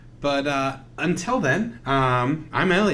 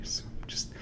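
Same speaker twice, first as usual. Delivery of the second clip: ASMR whisper; 3 semitones lower than usual. Pretend. ASMR haircut roleplay.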